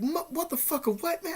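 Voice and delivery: very high-pitched, sputtering mock shock; shocked disbelief. Teen answering the unwanted phone call, shocked disbelief. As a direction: squeak out a retold startled protest.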